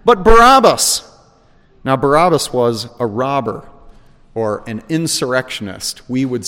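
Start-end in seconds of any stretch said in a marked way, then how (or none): 0.0-1.0 high, loud and slow cry; crowd crying out. A narrator's reading. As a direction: call it out like a demanding crowd. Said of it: "They cried out again".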